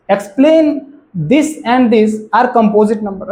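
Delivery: slow, loud reading of the question. Real, not acted. Teacher reading the question to the class, slow and emphatic. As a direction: read it out slowly with strong emphasis.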